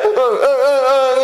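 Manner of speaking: high, slow hammed-up sobs; the boy breaking down crying in front of Jeomsun; mock sobbing. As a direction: wail like a boy bursting into tears. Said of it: "이래 되겠죠".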